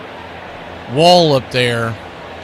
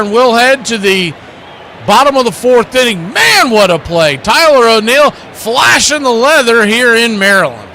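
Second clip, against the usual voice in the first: raised, loud sportscast call of a big play; excited play-by-play; 9 semitones higher, 6 dB louder than usual. Real, not acted. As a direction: call a spectacular catch at full excitement.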